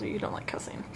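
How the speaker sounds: rushed whisper to viewers; fast whispered aside. Real, not acted. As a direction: whisper it fast, half to yourself.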